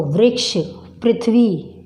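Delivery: वृक्ष as "vriksh", पृथ्वी as "prithvi"; an "i" vowel after the r in वृक्ष and पृथ्वी; correct "ri" sound. Shown as a demonstration. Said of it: "इस तरह से करते हैं इसका उच्चारण".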